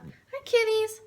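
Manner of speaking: high, loud hello to the cats; high pet greeting. Real, not acted. Vlogger breaks off to greet the cats, high-pitched pet voice.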